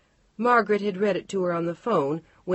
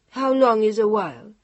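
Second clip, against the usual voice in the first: playful challenge; 5 semitones higher, 8 dB louder than usual. Acted. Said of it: "beginning to play now".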